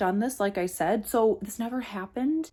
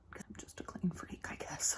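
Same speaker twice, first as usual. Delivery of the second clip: whispering; 2 semitones lower, 16 dB quieter than usual. Real, not acted. Aside, unvoiced whisper.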